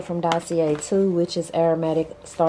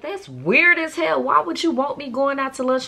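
incredulous; 8 semitones higher, 4 dB louder than usual. Retold or imitated. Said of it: "I would just be like".

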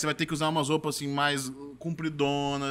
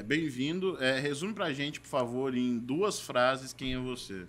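lower, softer, slow judge asking; 4 semitones lower, 5 dB quieter, slower than usual. Acted. Miguel asking the candidate to sum himself up, soft and low. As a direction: ask calmly like a low-key judge.

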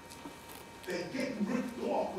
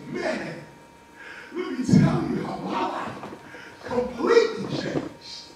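excited testimony; 7 semitones higher, 7 dB louder than usual. Retold or imitated. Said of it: "you'll say".

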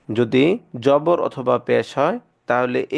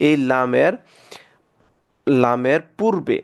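ordinary talk, nothing marked

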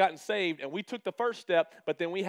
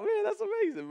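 awed. Retold or imitated. Retold awe at coach's saying.

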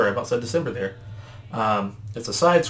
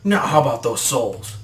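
slow, louder villain's demand; looming devil; 5 dB louder than usual. Acted. Devil looms over the trembling brothers, slow demand. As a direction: draw it out menacingly.

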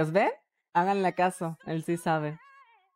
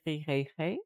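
soft hey; 6 dB quieter than usual. Shown as a demonstration. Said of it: "Cada cierto tiempo tengo que decir".

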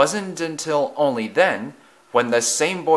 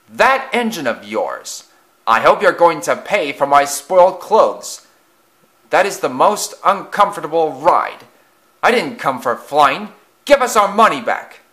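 loud, indignant passengers, building higher; angry complaints; 5 semitones higher, 6 dB louder than usual. A narrator's reading. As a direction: complain hotly, ending in a high demand.

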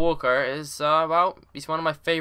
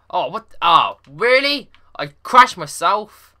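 exasperated; 5 semitones higher, 8 dB louder, slower than usual. Real, not acted. Crashes his own car.

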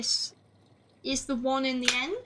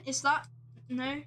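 ordinary talk, nothing marked

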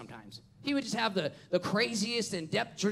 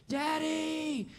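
put-on child calling; 9 semitones higher than usual. Preacher recalls the son's cry.

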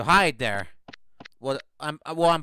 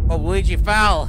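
loud alarmed outburst; panicking gamer; 4 semitones higher, 9 dB louder than usual. Real, not acted. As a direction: blurt it out in alarm. Character falls in game.

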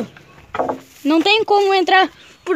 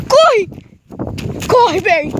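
panicked shout; 3 semitones higher, slower than usual. Real, not acted. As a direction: shout to flee, terrified. Runs away scared.

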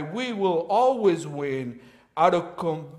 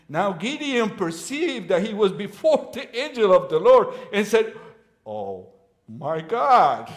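loud, high-pitched frightened cry to God; panicked Gideon; 4 semitones higher, 6 dB louder than usual. Acted. Loud frightened outburst. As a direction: Gideon panicking after seeing the angel.

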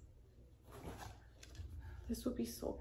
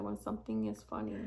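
a hint of a lower, quicker voice for the film character; lightly voiced quote; 12 semitones lower, 3 dB quieter, faster than usual. Retelling a film scene to viewers, quote dropped lower. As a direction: drop lower for her line, lightly. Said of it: "And she said".

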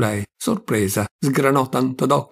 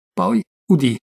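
plain reading voice

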